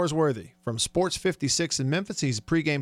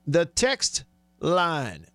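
TEXT and LINE drawn out and set apart; very slow, deliberate announcement; slow repeat; much slower than usual. Real, not acted. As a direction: stretch each word so listeners catch it. Repeats it slowly for listeners.

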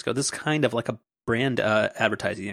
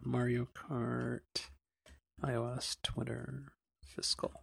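much softer, slow search mumble; absent muttering; 13 dB quieter, slower than usual. Real, not acted. Host hunting for the original tweet, muttering to himself. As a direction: mutter keywords to yourself, slowly.

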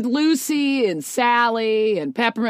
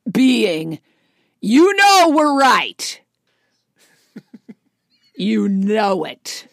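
taunting; 5 semitones lower, slower than usual. Acted. Taunting hateful comic fans.

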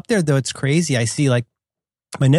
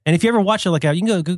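normal talking voice

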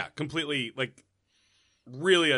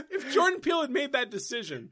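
high, laughing almost to tears; squeezing words out through laughter; 10 semitones higher than usual. Real, not acted. Cracking up at the joke.

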